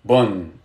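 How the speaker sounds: "Bun" said low, as B plus U plus N; pronunciation model. Shown as a demonstration. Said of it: "the dhamma sounds like U".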